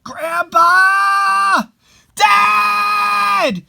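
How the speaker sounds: high, loud, overjoyed shouts; joyful calling out. Acted. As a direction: call out to loved ones come back. Family cries out to returned dead.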